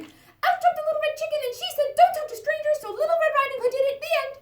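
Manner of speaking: high, fast, loud breathless chick; excited chick. Acted. Chick bursting into the fairy tale to warn Hansel and Gretel. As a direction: blurt it all out in a rush.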